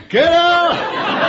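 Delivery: sudden high, comic yell; hammed-up piercing shout; exaggerated retold shout. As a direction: yell it far too hard for comedy. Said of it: "she didn't say it like that".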